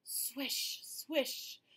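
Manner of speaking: airy leaf swish; whispered swish. Acted. Narrator voicing leaves on the roof, whispered. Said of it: "the leaves fell on the roof".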